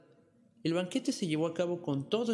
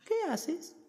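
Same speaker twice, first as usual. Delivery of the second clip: very high, curious princess; cartoon princess. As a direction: ask like a little storybook princess. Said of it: "dijo la princesa".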